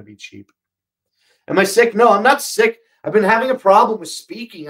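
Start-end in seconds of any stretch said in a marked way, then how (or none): 1.5-4.7 louder and higher, half calling out; loud, testing the voice; raised voice. Real, not acted. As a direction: project loud to reach the mic. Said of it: "stop yelling".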